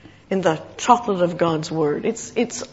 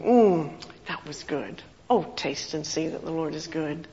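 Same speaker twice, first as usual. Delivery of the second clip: savoring murmur; 4 semitones lower, 7 dB quieter than usual. Retold or imitated. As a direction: linger over it like a treat. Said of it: "You think".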